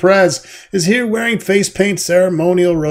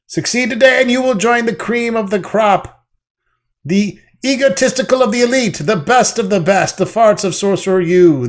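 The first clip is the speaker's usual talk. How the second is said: higher, louder bossy hazing boss; pledge master voice; 6 semitones higher, 5 dB louder than usual. Acted. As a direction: bark orders like a pompous pledge master. Pledge master continues speech.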